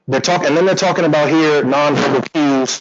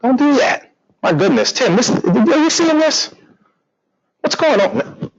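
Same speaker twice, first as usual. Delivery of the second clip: surprised; 12 semitones higher than usual. Real, not acted. Reacting to something on screen.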